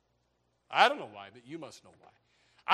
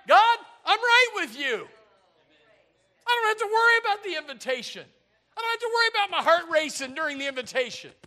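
high, loud rejoicing prayer; exultant high praise, half-laughing; joyful prayer; 10 semitones higher, 11 dB louder than usual. Acted. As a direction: lift it up to God in joy. Joyful prayer voiced loudly.

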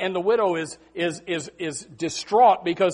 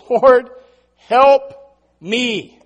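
each word of "Lord, help me" set apart; emphatic plea; 5 semitones higher, 10 dB louder, much slower than usual. Retold or imitated. Preacher quotes a worshipping mother, emphatic word-by-word plea. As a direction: punch "Lord" and "help" loud, pausing between.

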